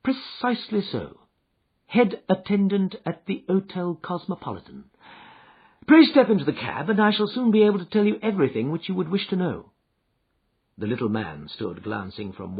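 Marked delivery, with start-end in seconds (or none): 0.0-9.7 Holmes, calm and assured. Acted.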